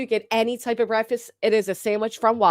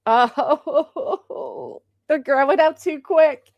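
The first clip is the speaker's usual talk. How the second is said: mock wail; 6 semitones higher, much slower than usual. Mock wailing over children growing up.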